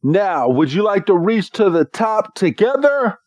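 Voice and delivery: a lightly voiced noble invitation; inviting knight. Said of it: "he's like".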